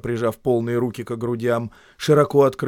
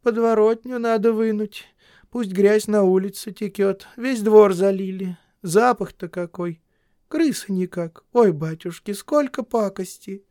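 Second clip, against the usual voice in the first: higher, louder weary housewife; woman's voice; 10 semitones higher, 6 dB louder than usual. A narrator's reading. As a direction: fuss tiredly about the yard. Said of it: "говоря".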